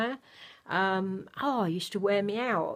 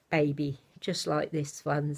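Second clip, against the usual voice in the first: usual speaking voice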